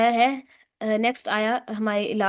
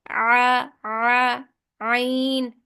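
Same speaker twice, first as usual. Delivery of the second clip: normal talking voice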